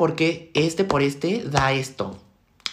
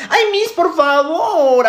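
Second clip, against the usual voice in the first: high, loud, drawn-out plea; whiny pleading; 10 semitones higher, 6 dB louder, slower than usual. Acted. Student begging the teacher for a hint, whiny pleading. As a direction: beg the teacher, stretching every word.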